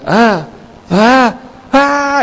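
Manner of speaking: mock "ah" cries climbing very high; imitated cameraman's cries. Man filming the tsunami from above, imitated rising cries. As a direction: mimic rising panicked cries without full volume. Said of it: "el que filmaba decía".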